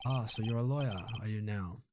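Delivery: put-on grand lawyer in a Southern accent. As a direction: play it as a pompous Southern gentleman. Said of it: "giving everybody southern accents".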